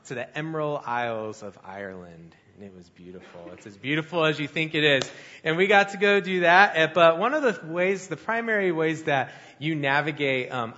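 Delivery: ordinary talk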